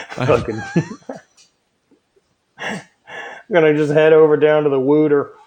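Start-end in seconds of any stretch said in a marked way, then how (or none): 2.6-5.3 put-on hamming up a rough Philly accent. Imitating Philly locals for chat, in a mock Philly accent. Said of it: "Fucking ridiculous accent".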